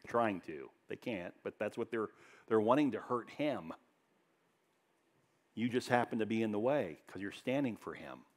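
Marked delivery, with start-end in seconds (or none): none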